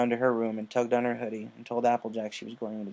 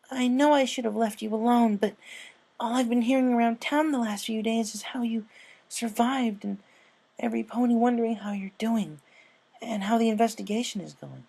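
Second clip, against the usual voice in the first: high, quick, earnest filly; a young fan rushing out her words; high filly voice, eager; 11 semitones higher than usual. Acted. Scootaloo voiced in story.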